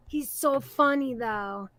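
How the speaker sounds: ordinary talk, nothing marked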